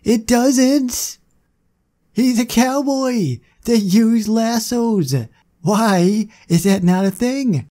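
high, indignant complaint at the game; real frustrated disbelief; exasperated outburst. Real, not acted. Frustrated the game lacks animation.

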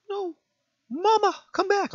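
high, loud mock child's wail; hammed-up abandoned baby cry; mock pleading. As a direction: call out for mama like a helpless chick. Mock helpless child calling mother.

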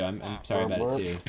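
ordinary talk

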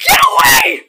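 shrieking high yell of terror; terrified scream. Real, not acted. Gamer screaming at the creature, terrified shriek. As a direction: scream it at the monster in panic. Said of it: "for that screeching hollering".

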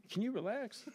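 imitating parents telling someone to relax; exasperated parents. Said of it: "the other parents were like".

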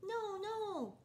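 high squeaky little pig. Acted. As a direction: pipe up high like a tiny pig. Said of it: "said the little pig".

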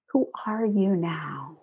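soft, slow whispered question. Real, not acted. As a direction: breathe the question out gently. Intimate guided question at close.

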